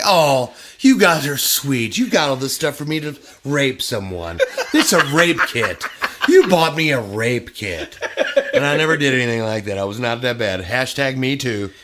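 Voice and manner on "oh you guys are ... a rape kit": loud, high, laughing mock thanks; mock grateful gushing. To the friends who built the shrine, loud laughing mock gratitude. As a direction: gush over the gift while laughing. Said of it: "It's like".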